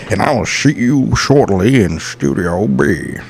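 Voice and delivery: slow Scottish Sean Connery drawl. Pretend. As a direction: suave Scottish film-star voice, slowed. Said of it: "That's my Sean Connery".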